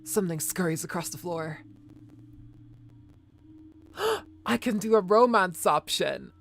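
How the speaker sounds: ordinary delivery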